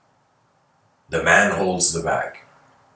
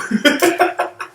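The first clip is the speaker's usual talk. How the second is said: breathy laugh. Real, not acted. Laughing at own impression.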